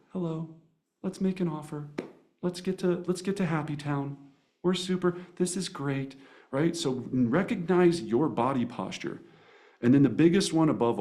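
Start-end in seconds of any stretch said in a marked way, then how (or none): 0.1-6.2 soft, welcoming invitation, quieter. Shown as a demonstration. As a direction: invite them in gently. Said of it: "we're welcoming, we're opening".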